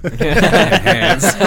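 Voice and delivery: Enhance squeaked high through laughter; laughing high. Retold or imitated. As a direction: laugh while saying Enhance. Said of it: "he was like".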